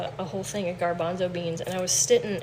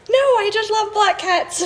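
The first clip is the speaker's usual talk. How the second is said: high, loud, drawn-out objection; mock indignant protest; 11 semitones higher, 9 dB louder, slower than usual. Cat rescuer answering accusers, high hammed-up protest. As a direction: protest loudly, pitched way up, about to laugh.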